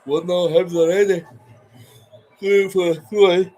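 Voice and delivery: loud high score call; commentary shout. Pretend. Commentary callout.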